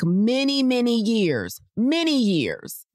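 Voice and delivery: many many years, rising high and slow; drawn-out emphasis. Shown as a demonstration. Telling viewers how many years walking adds, high and drawn out. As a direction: stretch the word many out high. Stretching out the lifespan gain.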